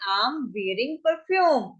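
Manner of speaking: drawn-out teaching delivery; slow demo phrase; slow model phrase. Shown as a demonstration. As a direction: stretch it so learners can follow. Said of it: "तो क्या कहेंगे".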